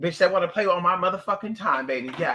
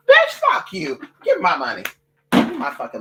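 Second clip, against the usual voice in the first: furious outburst; 6 dB louder than usual. Real, not acted. Ranting at the bank over fees, she erupts in an angry shriek. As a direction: explode at them.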